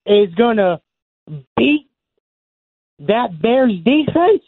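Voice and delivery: slow, high-pitched disbelief; incredulous. Real, not acted. Caller challenging host's Cowboys prediction, incredulous. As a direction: draw out each piece, voice climbing, incredulous.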